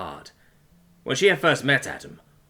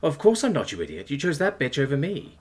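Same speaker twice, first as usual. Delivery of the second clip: high, exasperated inner outburst; hurt retort; 5 semitones higher than usual. A narrator's reading. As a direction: snap back silently at someone who chose another.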